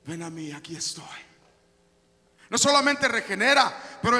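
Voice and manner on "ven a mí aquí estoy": low, soft divine reassurance; tender fatherly call. Acted. God's voice calling his child, tender and hushed. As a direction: gently call a child close. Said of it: "Es su voz que le dice".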